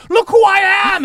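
high, loud theatrical scream. A narrator's reading. Floating head screams down at the players, screaming. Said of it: "she screams, and she goes".

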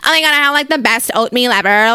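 a hint of a dainty girl gushing over breakfast; mild mock girly gush. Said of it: "am I sitting here like".

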